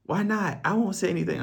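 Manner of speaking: high-pitched mock female voice; woman coaxing, imitated in a high voice; imitated pleading woman. Retelling woman pressing him.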